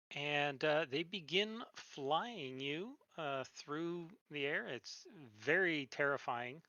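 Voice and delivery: slow scene-setting narration. A narrator's reading. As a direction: paint the scene slowly. Game master scene narration.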